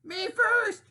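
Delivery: high, loud greedy piglet cry; pushy pig crying out. Acted. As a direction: shout it like a pig who must be first. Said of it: "cried Pinkerton".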